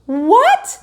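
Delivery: a mother who can't believe her ears; disbelieving. A narrator's reading. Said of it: "like she couldn't believe it".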